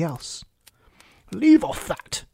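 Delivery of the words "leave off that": high shrieked order; screamed. A narrator's reading. Queen stops the bowing gardeners, high scream. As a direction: screech it at fawning subjects. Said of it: "screamed the Queen".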